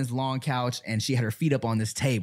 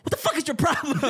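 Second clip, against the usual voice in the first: high-pitched mock outburst; pissed-off friend; faster than usual. Storyteller imitates Rachel about to pop off, mock pissed-off. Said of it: "Rachel is pissed, about to pop off".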